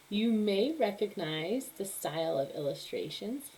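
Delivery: ordinary delivery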